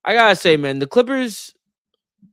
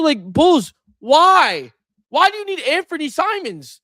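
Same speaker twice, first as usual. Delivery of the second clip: exasperated; 10 semitones higher, slower than usual. Real, not acted. Exasperated rant about the trade.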